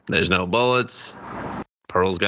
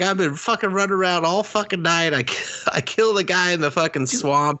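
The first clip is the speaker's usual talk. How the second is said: higher-pitched worked-up old man, lightly voiced; mock exasperated rant; 8 semitones higher than usual. Voicing Howard with his bad heart, mock exasperated rant. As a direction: imitate an overwrought husband listing his night.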